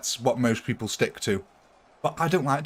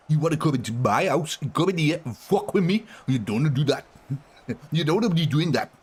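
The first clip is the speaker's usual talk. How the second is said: mock South American accent; 4 semitones higher than usual. Streamer joking about accents, menacing mock South American voice. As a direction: growl it like a tough guy from South America. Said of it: "South American accent is like".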